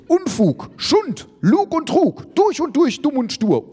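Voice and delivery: high-pitched furious list of insults; angry cursing. A narrator's reading. Ulf cursing the others' lies, angry. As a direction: curse in high, punched bursts. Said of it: "Ulf flucht rum".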